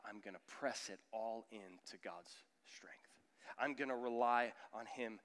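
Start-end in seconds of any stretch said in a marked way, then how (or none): 0.1-3.0 hushed, intimate whisper. Real, not acted. Pastor leans in on relying on God, whispering. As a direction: breathe it out quietly.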